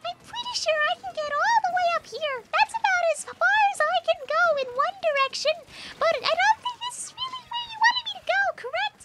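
squeaky high voice, far up the range; joke very high voice. Shown as a demonstration. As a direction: pitch the voice way up as a joke. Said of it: "What is the deepest voice you can do?".

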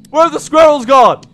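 usual speaking voice

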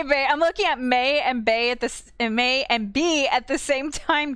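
normal talking voice

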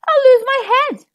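high, loud frightened cry; a frightened barber shrieking high; panicked cry. Acted. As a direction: cry out in terror of losing your head. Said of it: "shouted the barber".

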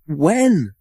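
high, eager squeak. A narrator's reading. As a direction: squeak it out in sudden excitement. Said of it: "they squeaked in unison".

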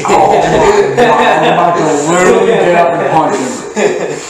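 loud, exasperated, in pain; loud outburst. Real, not acted. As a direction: blurt it out loud and frustrated. Furious at teasing friend.

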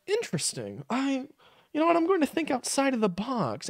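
mock-pensive kids, very soft; hushed imitation of kids having a revelation; imitated impressionable kids. Imitating kids buying into conspiracy theories, mock-pensive and hushed. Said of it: "kids who were all like".

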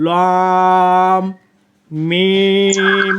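লম and মিম each held very long and slow; lengthened letters. Shown as a demonstration. Teacher showing how long to hold লম and মিম, stretched. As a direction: stretch লম, মিম four beats. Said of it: "মিমও চার আলিফ হবে".

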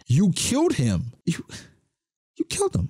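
high, strained accusation that breaks upward; mad and crying. Retold or imitated. Bonnie accusing Vegapunk of killing her dad, tearful and angry. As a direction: accuse him through tears, voice cracking. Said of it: "She is mad".

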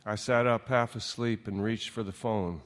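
usual speaking voice

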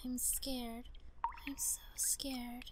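whispered and slow, no voice; scared whisper. Acted. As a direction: whisper like a frightened child.

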